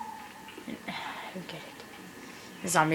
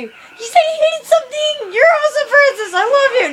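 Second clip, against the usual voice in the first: much higher and loud, giddy cheering; hyper squealing; 11 semitones higher, 4 dB louder than usual. Real, not acted. Gamer cheering Francis for saying he hates something, giddy squeals. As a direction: cheer like you can't contain yourself. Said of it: "really, really hyper".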